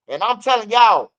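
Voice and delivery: usual speaking voice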